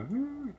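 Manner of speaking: a high, brief hum for a forced smile; mock polite hum. Shown as a demonstration. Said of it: "like this".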